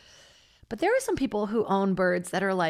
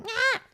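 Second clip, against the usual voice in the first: bird squawk. Retold or imitated. Imitating the neighbour's yelling bird.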